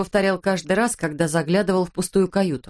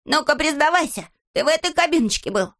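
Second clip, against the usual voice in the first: high, insistent questioning; agitated, high-pitched grilling; demanding interrogation; 8 semitones higher than usual. Acted. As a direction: demand a confession at a raised pitch. Acted interrogation, dragging friend along.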